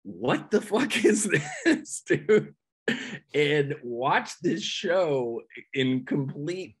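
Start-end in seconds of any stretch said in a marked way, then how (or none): 0.0-2.5 high, laughing disbelief; stunned, giggling high voice; amazed laughing. Retold or imitated. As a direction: laugh through the shock of it. Tripping at a shocking show.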